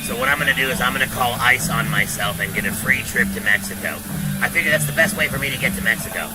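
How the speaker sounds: ordinary delivery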